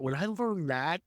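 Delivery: ordinary talk, nothing marked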